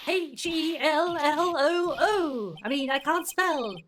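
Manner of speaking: loud, high, drawn-out letters; exasperated calling out. Acted. Maya spelling hello at silent Vasquez, loud exasperation. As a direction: spell it out slowly and loudly.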